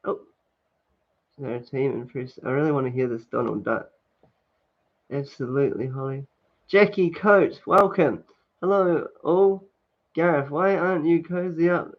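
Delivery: ordinary delivery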